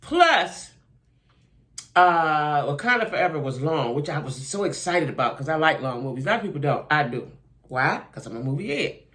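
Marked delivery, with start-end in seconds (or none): none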